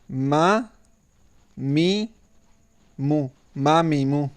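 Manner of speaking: slow, clear teacher reciting each syllable; syllable drill. Shown as a demonstration. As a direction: recite the syllables for a pupil to repeat. (धीमे और साफ़ अक्षर पढ़ाना)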